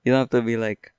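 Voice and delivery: ordinary talk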